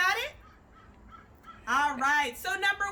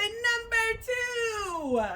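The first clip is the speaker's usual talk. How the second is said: long, stretched call, higher; drawn-out call; 6 semitones higher, much slower than usual. Shown as a demonstration. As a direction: call it out for the kids to echo. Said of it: "Everybody say".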